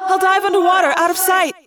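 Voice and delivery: high, urgent yelled plan with echo; Cassie. A narrator's reading. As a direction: call out the rescue plan fast and urgently. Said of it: "Cassie yelled".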